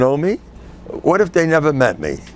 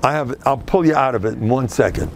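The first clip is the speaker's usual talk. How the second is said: usual speaking voice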